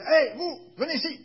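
loud, high commanding shout; sharp, self-assured summons; intimidating shout. Acted. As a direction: bark an order to intimidate someone. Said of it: "rien qu'avec le ton, on peut intimider quelqu'un".

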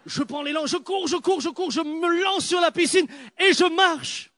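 mounting excitement, voice climbing; excited run-up. Retold or imitated. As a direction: build the rush of the run-up. Acts out running at the pool.

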